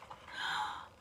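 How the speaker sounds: whispered gasp; a sharp breath in. Real, not acted. Narrator reacts.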